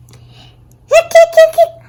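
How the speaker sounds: high squeaky hiccup sounds, giggling. Acted. Girl hiccuping through the play rehearsal, high giggly hiccups. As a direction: hiccup in little high yelps.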